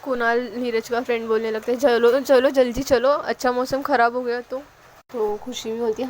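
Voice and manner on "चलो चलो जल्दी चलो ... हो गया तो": calling out. Acted. Friend hurrying the group before the weather turns, loud call.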